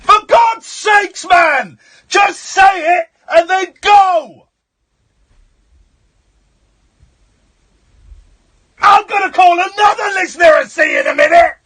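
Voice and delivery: yelling in frustration; furious, loud and high; angry shout. Real, not acted. Host shouts at rambling caller.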